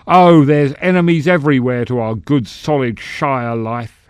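loud stump-speech cry to the crowd; rousing cry. A narrator's reading. Senator rousing his halfling crowd, loud cry. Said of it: "he finally cried".

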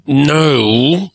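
through gritted teeth. A narrator's reading. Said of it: "through gritted teeth".

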